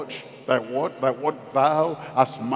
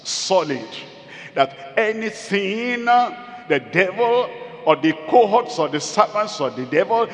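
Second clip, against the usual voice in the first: raised, insistent pulpit proclamation; forceful preaching; 4 dB louder than usual. Real, not acted. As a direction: preach it with mounting conviction.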